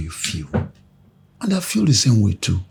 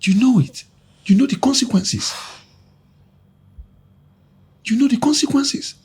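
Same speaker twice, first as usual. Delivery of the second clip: much higher, urgent pitch; anxious insistence; 14 semitones higher than usual. Acted. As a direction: press the warning home, voice raised high. Acted quarrel, insisting on the danger.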